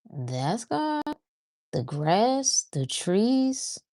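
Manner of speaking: "That's God?", "The grass", "the trees?" each lifting into a soft question; question-mark intonation. Shown as a demonstration. Teacher mimicking an unsure listener, each phrase a soft rising question. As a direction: let "God", "grass" and "trees" rise as if asking. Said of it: "saying it like it's a question mark".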